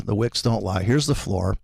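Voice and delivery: ordinary talk, nothing marked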